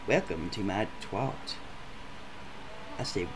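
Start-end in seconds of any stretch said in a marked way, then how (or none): 0.1-1.6 low, quiet and matter-of-fact; hushed, deadpan date; deadpan quote. Retold or imitated. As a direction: say it like a dull tour guide. Date's flat announcement.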